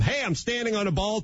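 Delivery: high, pleased little boast; mock a proud show-off on a high note; feeling really good. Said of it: "feeling really good".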